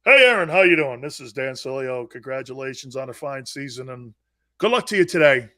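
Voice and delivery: loud hello, then low formal courtesy; mock polite greeting. Player mocking younger guys' pre-game niceties to Aaron, mock politeness. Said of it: "what some of you guys today and some of these younger millennial guys want".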